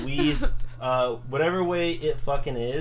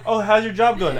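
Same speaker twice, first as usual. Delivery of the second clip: higher, louder imitation of a questioner; mock small-talk question; 7 semitones higher, 7 dB louder than usual. Partner mimics being asked about the job, high, loud mock question. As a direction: pitch it up to mimic polite asking.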